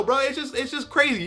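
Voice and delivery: usual speaking voice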